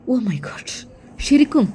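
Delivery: breathless whispered gasp of shock; shocked brother whispering in disbelief. Acted. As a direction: whisper it as if stunned. Shocked at sister's trouble.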